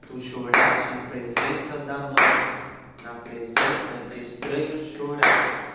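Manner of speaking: loud, slow verse with heavy beats; loud, slow, measured recitation; rhythmic verse recitation. Shown as a demonstration. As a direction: declaim it slowly, hitting the third, sixth and ninth syllables. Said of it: "a gente vai ter outro padrão".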